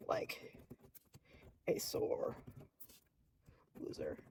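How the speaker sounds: very soft, broken-up whisper; barely audible, halting self-dictation; murmuring while writing. Real, not acted. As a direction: murmur each word to yourself as you write.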